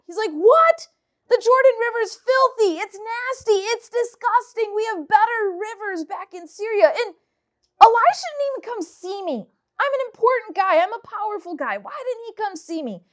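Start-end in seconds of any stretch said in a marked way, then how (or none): 0.3-6.9 higher, louder indignant outburst; upset Naaman. Acted. Naaman, a proud commander, refusing the Jordan River: indignant, raised voice. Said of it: "Naaman gets super upset". 7.8-13.0 higher, indignant complaining voice; offended pride. Acted. Naaman, offended that Elisha sent a servant: indignant complaint. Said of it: "he's upset".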